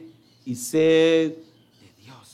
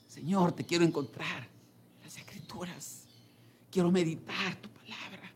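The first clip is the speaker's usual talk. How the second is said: hushed prayer; 11 dB quieter than usual. Acted. Voicing a believer's prayer.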